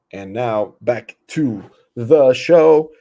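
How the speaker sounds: slow, loud, booming announcer; stretched radio announcer back from the break. Acted. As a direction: boom it out like a radio host. Radio-style segment return.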